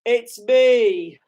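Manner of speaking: high, loud, amazed; stunned. Real, not acted. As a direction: say it in disbelief. Recognizes himself in the crowd.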